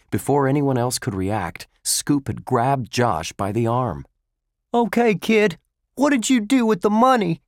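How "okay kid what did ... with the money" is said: loud, high, fast demand. A narrator's reading. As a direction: bark the question at the kid. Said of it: "he demanded".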